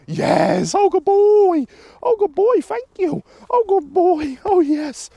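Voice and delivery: shower the dog with squealed praise; excited praise. Real, not acted. Trainer praises the dog for coming when called, excited praise. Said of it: "he's getting vocal inflection".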